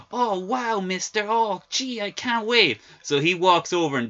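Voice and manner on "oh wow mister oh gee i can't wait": much higher gee-whiz gushing; young trucker gushing about meeting Hoffa; mock naive kid. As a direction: gush like a starstruck innocent. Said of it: "And the guy's like".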